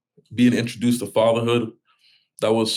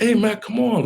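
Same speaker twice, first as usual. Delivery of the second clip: much higher-pitched mock call to his son; retold yell; 12 semitones higher than usual. Father replaying how he called out his son, high mock yell. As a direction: replay the sharp call at the kid. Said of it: "and I yelled".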